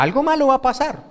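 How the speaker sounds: imitated fearful expectation; acted dread. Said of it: "Es ese temor, ¿cierto?".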